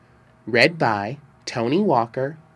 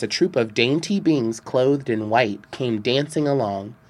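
usual speaking voice

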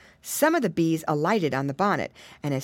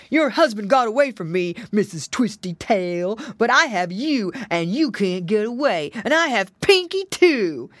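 louder, menacing storybook wolf; gloating wolf cry; 3 semitones higher, 5 dB louder than usual. A narrator's reading. Wolf gloats to his captured pigs, crying out. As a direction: crow over your captives as the big bad wolf. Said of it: "cried the wolf".

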